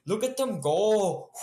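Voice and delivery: high, put-on muscleman boast; showboating muscleman. Huge brute flaunting his muscles at the party, hammed-up boasting. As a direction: show off the biceps in a hammed-up high voice. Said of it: "And the guy says".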